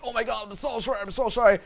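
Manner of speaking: high, gushing mock apology; over-apologetic. Coach showing how not to say sorry, over-apologetic. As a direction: grovel in a hammed-up high voice. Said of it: "all over apologetic".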